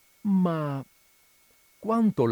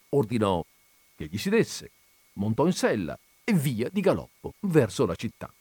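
ordinary delivery

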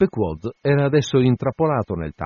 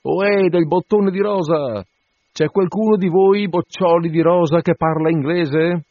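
high, loud hail across the water; shouting; 8 semitones higher, 5 dB louder than usual. A narrator's reading. Sailor hailing the French ship for an English speaker, shouting. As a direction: call out to a ship's crew. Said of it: "urlò".

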